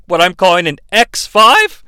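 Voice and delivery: normal talking voice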